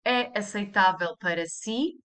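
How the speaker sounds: "É aceitável para si" climbing on the final "si"; question intonation. Shown as a demonstration. Teacher asking a formal subject, rising tune on "si". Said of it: "You just raise the intonation".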